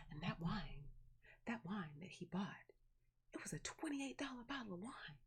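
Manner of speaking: soft, whispery boast imitating aunt; mock hushed awe. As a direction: drop to a reverent near-whisper, imitating a boast. Acts aunt boasting about pricey wine.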